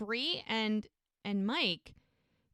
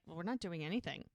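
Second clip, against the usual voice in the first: softer, lower, mock innocence; sheepish denial; 5 semitones lower, 10 dB quieter, faster than usual. Parents caught laughing by their daughter, sheepishly quiet. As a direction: play it down, caught out. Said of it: "they both clear their throats".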